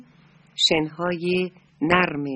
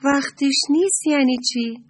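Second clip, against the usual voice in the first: higher, louder, puzzled asking; surprised question; 7 semitones higher, 5 dB louder than usual. Acted. The little moon asking the crab in surprise, higher and louder. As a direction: ask it in real disbelief. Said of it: "با تعجب پرسید".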